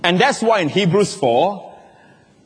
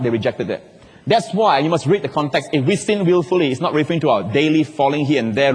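ordinary delivery

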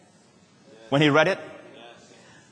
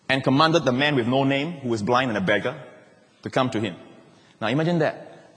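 ordinary talk

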